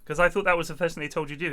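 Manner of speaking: ordinary talk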